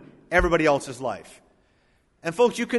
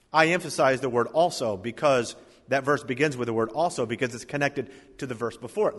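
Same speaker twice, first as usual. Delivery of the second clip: normal talking voice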